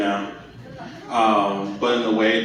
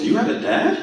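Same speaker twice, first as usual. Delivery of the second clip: incredulous; 13 semitones higher than usual. Retold or imitated. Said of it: "the first thing that pops up is".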